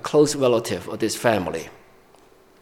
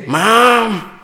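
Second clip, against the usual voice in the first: put-on a higher, louder whiny kid protesting to mom; reluctant child; 6 semitones higher, 3 dB louder than usual. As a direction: pout like a child dragged out to play piano. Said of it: "And they'll say".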